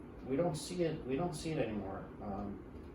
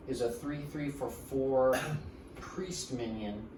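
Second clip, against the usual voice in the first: normal talking voice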